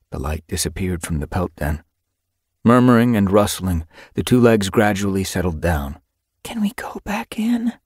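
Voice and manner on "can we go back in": whisper. Acted. Whispering beside sleeping two-legs.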